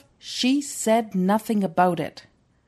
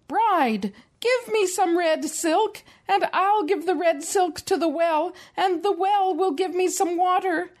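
higher, breathless little rooster listing his errand; rooster pleading; 8 semitones higher than usual. A narrator's reading. Mom voicing the rooster begging the bride for silk. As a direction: rooster rattling off the whole chain to the bride. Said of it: "The little rooster ran to the bride".

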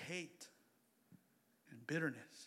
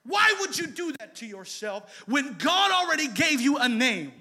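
ordinary talk

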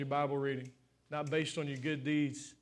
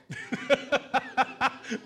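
long, high run of chuckles; laugh; 6 semitones higher than usual. Real, not acted. Preacher laughing at how we all tried, rapid laughter. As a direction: laugh it out in a quick string.

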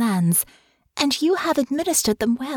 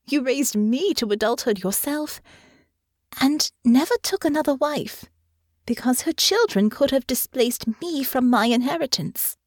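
put-on higher, earnest young woman; sincere reassurance; 7 semitones higher than usual. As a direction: reassure him tenderly. Honor reassures father.

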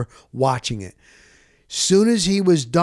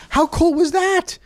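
high, thrilled exclaim; first take lifted higher than the second; excited; 13 semitones higher than usual. Real, not acted. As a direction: gush over how cool it was. Excited repeat of a line.

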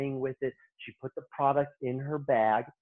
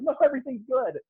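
eager reassurance; 9 semitones higher, 3 dB louder, faster than usual. Retold or imitated. Reassuring the boss.

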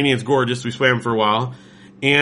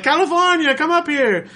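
Olive Oyl call; 14 semitones higher than usual. Retold or imitated. Olive Oyl calling the visitor onto the stage, high and bright. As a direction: summon them up in a squeaky voice. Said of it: "she's like".

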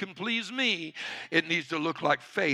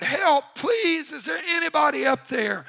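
high, pleading shout for help; acted desperate cry; more desperate; 10 semitones higher, 5 dB louder than usual. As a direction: beg loudly for a rescuer, pitched way up. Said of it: "he became more desperate".

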